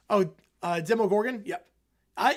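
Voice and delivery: ordinary talk, nothing marked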